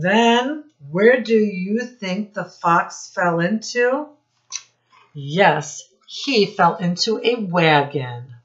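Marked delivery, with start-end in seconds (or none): none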